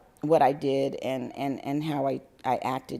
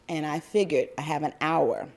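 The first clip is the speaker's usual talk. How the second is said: ordinary talk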